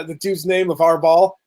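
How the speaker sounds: usual speaking voice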